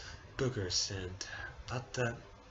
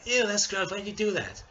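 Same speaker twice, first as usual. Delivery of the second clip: grossed out; 10 semitones higher, 6 dB louder than usual. Retold or imitated. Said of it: "probably most of you are like".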